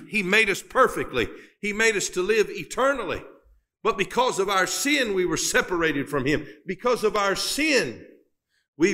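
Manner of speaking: high, ringing preacher's cadence; rising preaching. Acted. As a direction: lift the congregation with it. Preacher lifts pitch, building.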